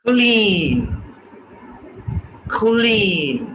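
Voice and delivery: क्लीन with puff on k; aspirated k. Shown as a demonstration. Teacher models क्लीन aspirated. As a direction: say क्लीन as khlin. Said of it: "next example".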